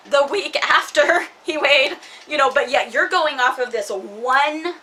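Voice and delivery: anger. Real, not acted. Venting to friend about the court ruling, loud angry outburst. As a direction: voice rising in real frustration. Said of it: "that's anger".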